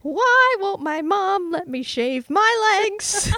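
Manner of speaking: put-on high, slow whiny teen complaint; whiny teenager. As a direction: pout like an angsty teen. Playing angsty teen Justine.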